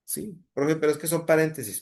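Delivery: high-pitched, quick imitation of a student; objecting student. Teacher voicing a pupil's doubt about parentheses, high objecting voice.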